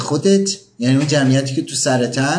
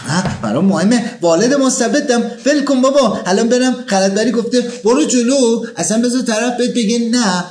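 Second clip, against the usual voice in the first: exasperated inner protest; 9 semitones higher than usual. Acted.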